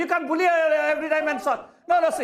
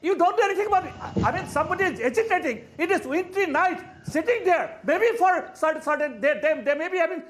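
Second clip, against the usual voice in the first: heated, pleading plea for sympathy; worked-up panelist lecturing the anchor; 5 semitones higher than usual. Real, not acted. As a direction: pitch up, slowed in spots. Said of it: "I'm getting worked up".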